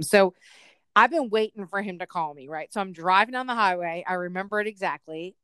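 ordinary talk

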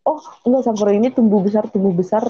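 ordinary talk, nothing marked

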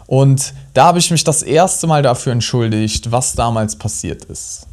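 usual speaking voice

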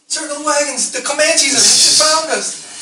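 high-pitched, hammed-up warning cry; mock alarm call. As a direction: raise a playful, slow-building alarm. Mock alarm as monster approaches.

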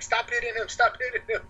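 imitated friend, high-pitched protest; alarmed friend. Friend intervening in the slapping.

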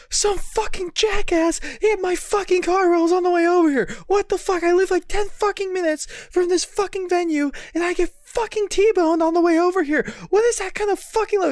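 high-pitched ranting imitation of a furious friend; really mad. Friend climbs out of his wrecked car outside the show, mad rant. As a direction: rant indignantly, voice pushed way up. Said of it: "he's, like, really mad".

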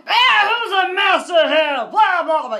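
loud, high mock challenge; challenger's shout. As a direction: holler it out at the master. Challenger shouting at next master.

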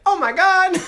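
very high-pitched impression; high put-on character voice; Carlito. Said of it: "I'm gonna do Carlito".

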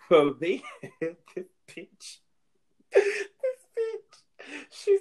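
high, squeaky words broken by laughing; helpless laughter. Real, not acted. Storyteller cracking up over the sister's name story, helpless laughter. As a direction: barely get the words out for laughing.

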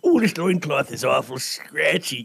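slow, lightly voiced old man grumbling; a hint of a creaky old man complaining. Player retelling playing the old man at the table, lightly voiced old man. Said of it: "I got to be the old man".

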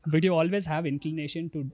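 ordinary delivery

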